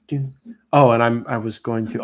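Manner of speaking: ordinary delivery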